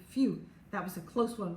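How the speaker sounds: usual speaking voice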